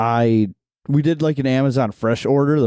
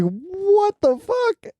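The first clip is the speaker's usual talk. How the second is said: much higher, drawn-out squeak of disbelief; stunned disbelief; much slower than usual. Retold or imitated. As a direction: stretch it slowly, squeaking in shock. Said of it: "I was like".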